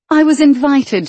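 high, indignant protest; defensive. Acted. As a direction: defend yourself, affronted. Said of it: "she defended herself".

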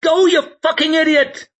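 very high, loud furious shriek; frantic, piercing yell. A narrator's reading. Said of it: "shrieked Shy".

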